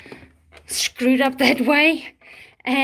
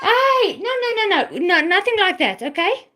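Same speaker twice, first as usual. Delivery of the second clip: sharp telling-off; 7 semitones higher than usual. Real, not acted. Scolding someone off camera.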